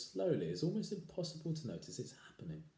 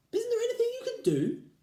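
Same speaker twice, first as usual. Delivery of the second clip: very high, loud pleading; crying wife; 9 dB louder than usual. Acted. Wife pleading with her husband, high and loud. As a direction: plead desperately. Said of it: "cried Missus Twit".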